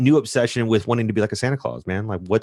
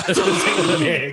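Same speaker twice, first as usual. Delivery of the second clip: put-on Transylvanian accent; 4 semitones higher, slower than usual. Mimicking the accent, laughing.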